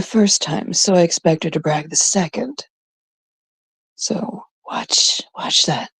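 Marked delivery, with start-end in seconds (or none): 4.0-5.9 hushed teasing whisper; secretive whisper. Real, not acted. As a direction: lean in and whisper it to the chat. Whispered teaser to viewers.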